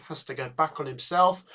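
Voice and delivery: usual speaking voice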